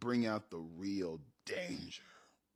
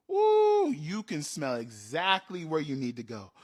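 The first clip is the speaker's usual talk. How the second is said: excited ooh; 3 semitones higher, 7 dB louder than usual. Retold or imitated. GM rewards a high roll.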